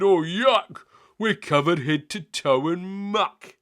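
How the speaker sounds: high loud disgusted cry; disgusted yuck. Acted. Hugh covered in muck, high loud disgust. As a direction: wail at the muck. Heap collapsed on them.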